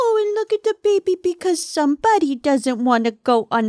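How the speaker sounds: mock baby talk with a pointed dig; passive-aggressive baby talk. Owner digging at partner through the dog, baby talk. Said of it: "talking baby talk to the dog".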